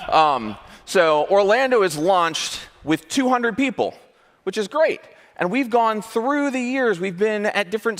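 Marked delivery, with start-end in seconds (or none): none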